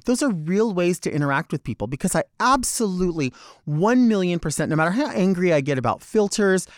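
ordinary talk, nothing marked

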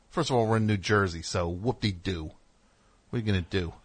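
ordinary talk

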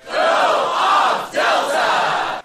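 slow, high, breathy whispered title call; drawn-out stage whisper. Acted. Host announcing the segment title in a slow whisper.

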